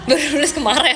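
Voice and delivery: sheepishly amused, laughter breaking in; laughing admission. Real, not acted. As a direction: say it through a giggle. Amused at writing so late.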